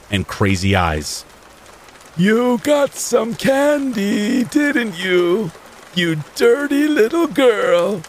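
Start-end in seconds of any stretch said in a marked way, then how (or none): 2.2-8.0 put-on high, slow creepy sing-song old man. A narrator's reading. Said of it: "he said in a sing-song type of voice".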